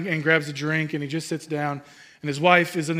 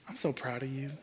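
lower, very soft imitation of a proud wife; tender praise; 4 semitones lower, 14 dB quieter than usual. Wife to husband who cleaned the pool, tender quiet praise. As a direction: murmur it softly, as retold praise.

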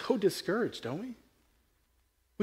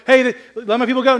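quick, higher and louder demand; mock Moses demand; 8 semitones higher, 10 dB louder, faster than usual. Preacher as Moses demanding Pharaoh free people, quick and loud.

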